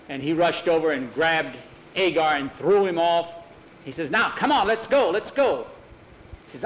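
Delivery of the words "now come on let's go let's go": high, pressing command; urgent hurrying. Acted. Hurrying the boys out of danger, urgent high call. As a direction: hurry everyone along, pitch raised.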